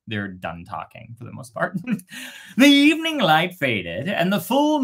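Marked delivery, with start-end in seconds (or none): none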